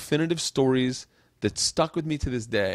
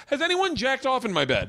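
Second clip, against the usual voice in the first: rising, pitched-up suspicious dad; a suspicious father getting higher; dad's suspicious questions; 12 semitones higher than usual. Retold or imitated. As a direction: press them like a suspicious father.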